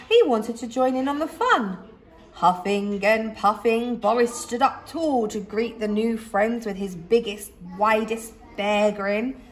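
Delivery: plain reading voice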